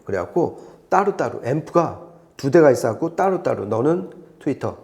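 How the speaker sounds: ordinary talk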